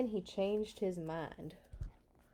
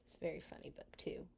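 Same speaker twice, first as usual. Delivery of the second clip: whisper; 4 semitones lower, 13 dB quieter, much faster than usual. Real, not acted. Aside whispered off-mic.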